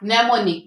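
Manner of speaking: mnemonic begun straight on the n; silent m. Shown as a demonstration. Teacher models the silent m for students. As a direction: start mnemonic with no m sound. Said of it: "start में silent है एम".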